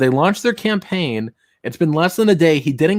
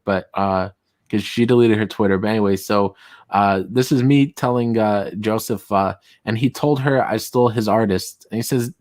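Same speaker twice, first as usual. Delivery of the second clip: ordinary delivery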